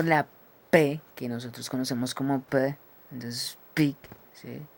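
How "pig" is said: "pig" with a clear English P; English P demo. Shown as a demonstration. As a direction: demonstrate the P at the start of "pig". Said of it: "más vocal".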